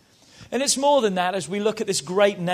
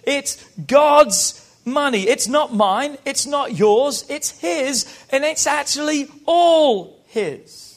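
louder, higher, slow emphatic preaching; 5 semitones higher, 4 dB louder, slower than usual. Acted. Pastor driving home that it's God's, preaching. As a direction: drive it home to the church.

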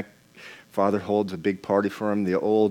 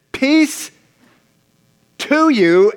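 preached quote; 13 semitones higher, 6 dB louder, much slower than usual. Acted.

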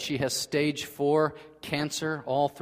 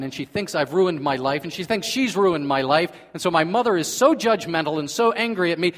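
building louder and higher sermon indignation; impassioned preaching; 3 semitones higher, 6 dB louder than usual. Acted. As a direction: rise into full-force preaching over the injustice. Preacher rises to indignant peak.

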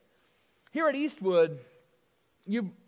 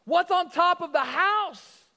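eager owner; 7 semitones higher, 4 dB louder, slower than usual. Acted. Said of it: "the guy was like".

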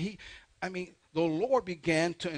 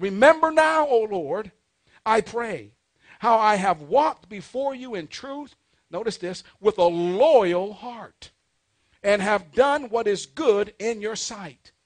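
loud, raised-pitch declaimed prayer; scripture reading; 4 semitones higher, 9 dB louder than usual. Real, not acted. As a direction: call the prayer out slowly from the pulpit. Reads king's prayer from scripture.

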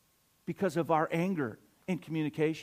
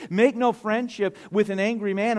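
louder, higher preaching voice; raised, emphatic Bible quote; forceful scripture quote; 4 semitones higher, 6 dB louder than usual. Real, not acted. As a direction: proclaim the verse with force. Same verse repeated with preaching force.

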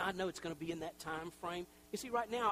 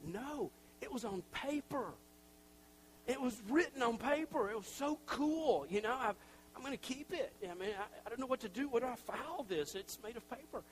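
higher, gushing delight over a paper letter; thrilled; 7 semitones higher than usual. Retold or imitated. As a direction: marvel at it like a treasure.

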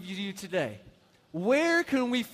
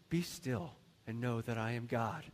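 low, hushed and serene verse; gentle, soft reading; 10 semitones lower, 8 dB quieter than usual. Shown as a demonstration. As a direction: read it softly and calmly, well under your usual voice. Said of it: "So we read it and we say".

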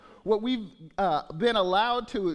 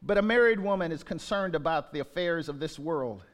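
scripture reading; 4 semitones lower than usual. Acted. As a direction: read each verse deliberately. Reads scripture verses aloud.

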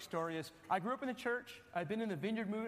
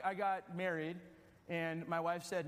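normal talking voice

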